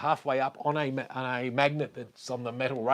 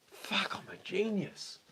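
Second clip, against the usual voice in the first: whispered; 10 semitones higher, 6 dB quieter, slower than usual. Real, not acted. Whispered triumph over mic setup.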